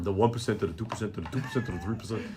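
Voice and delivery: low, fast hammed-up Bernie Sanders; Bernie Sanders impression. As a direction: rattle off percents like Bernie. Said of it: "a very nice impression of that Bernie Sanders".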